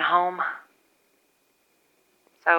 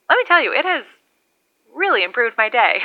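high, pleased and bright; excited; 6 semitones higher, 4 dB louder than usual. Real, not acted. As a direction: let the joy jump out. Pleased exclamation.

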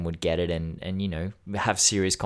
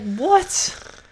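high-pitched, louder alarmed What, voice cracking up; shocked disbelief; 11 semitones higher, 6 dB louder than usual. Retold or imitated. To his GP about the risk, alarmed high squeak. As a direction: squeak out the panic at the news. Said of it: "And I was like".

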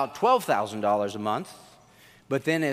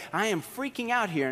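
mild imitation of panic, pitched up; retold freak-out; 5 semitones higher, 4 dB quieter than usual. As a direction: lightly play the panicked friend. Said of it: "He's like".

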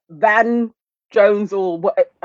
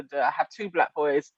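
ordinary delivery

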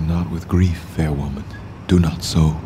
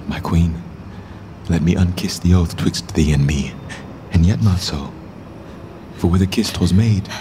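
ordinary talk, nothing marked

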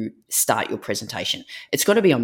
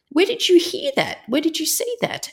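a flustered mum probing; alarmed high questioning. Retold or imitated. Mum driving, grilling her daughter in high alarm. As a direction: pitch way up, anxious and pressing.

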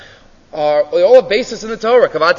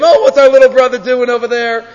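higher, louder scoffing voice; mocking brothers; 5 semitones higher, 4 dB louder than usual. Older brothers laughing at little David, mocking. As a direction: jeer like a sibling.

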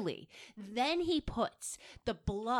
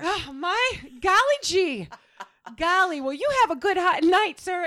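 much higher and louder, gushing with glee; overjoyed old-fashioned gentleman; giddy golly reply; 8 semitones higher, 8 dB louder than usual. Pretend. Host voicing Hyde accepting the tickets, giddy and loud.

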